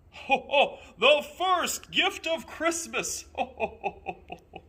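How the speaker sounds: high, loud laughing Santa cry; jolly Santa calling out. A narrator's reading. Santa holding up the bell, calling out to the elves, loud and laughing. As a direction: boom it out, laughing with joy. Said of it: "and called out".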